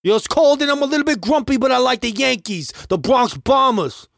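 bad New York accent. Host showing co-host his best New York accent, hammed up. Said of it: "how anyone here speaks".

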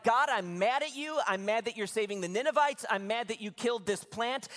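high, loud mock outburst; angry, miserable Jonah. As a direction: whine up at God as a fed-up prophet, imitating. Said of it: "Jonah's just miserable".

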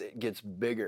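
quietly landing the point. Shown as a demonstration. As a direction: let it sink, almost under the breath. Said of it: "repeats the point".

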